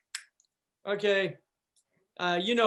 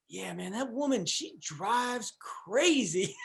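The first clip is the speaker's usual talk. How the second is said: soft, drawn-out impression of his friend; slow right down, quietly, quoting a buddy; friend's retold remark; 5 dB quieter, slower than usual. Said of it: "and he goes".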